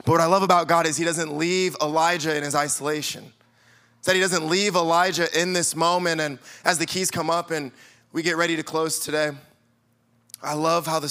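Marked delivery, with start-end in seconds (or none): none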